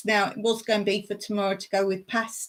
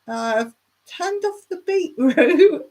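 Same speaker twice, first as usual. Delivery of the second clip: higher, slow mock voice for husband; quoting Mike; 6 semitones higher, 4 dB louder, slower than usual. Wife mimics husband forgetting the beetroot, higher and slow. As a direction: drawl it out like a clueless husband. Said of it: "Mike said".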